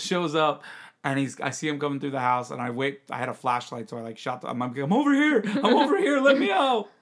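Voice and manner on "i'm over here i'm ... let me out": high calling out from the coop. Retold or imitated. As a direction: shout to be found. Calling out to brother.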